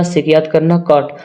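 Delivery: ordinary delivery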